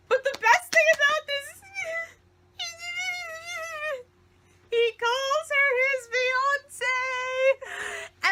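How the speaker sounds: high laughing gush. Real, not acted. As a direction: squeal through laughter. Gushing over scene, laughing.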